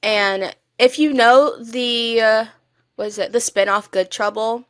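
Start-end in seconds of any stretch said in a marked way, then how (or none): none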